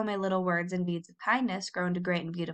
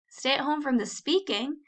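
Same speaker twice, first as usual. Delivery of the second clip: dismay; 6 semitones higher than usual. A narrator's reading. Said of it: "cried Caddy in dismay".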